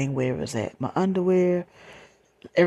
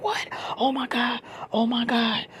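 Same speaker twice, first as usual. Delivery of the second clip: high, alarmed re-enactment; retold gasping alarm, higher voice; shocked reaction; 9 semitones higher than usual. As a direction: imitate her shocked disbelief, pitch jumping up. Goddaughter's shocked reaction acted out.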